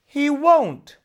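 high and loud, firm; determined. A narrator's reading. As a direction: cut off any doubt. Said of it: "said Nora determinedly".

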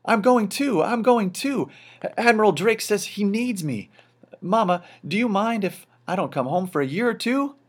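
overjoyed, can't contain it; excited. A narrator's reading. Popper telling his wife he's going, high and excited. Popper thrilled to join the voyage.

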